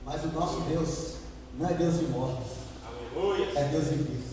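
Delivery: low, soft, measured sermon voice; hushed preaching. Real, not acted. Preacher setting the scene at Lazarus's tomb, hushed. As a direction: draw the church in quietly with pauses.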